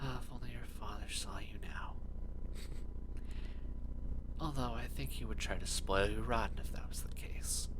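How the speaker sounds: tender whispered mother's voice; a loving mother, breathed like a ghost; whispering ghost mother. Acted.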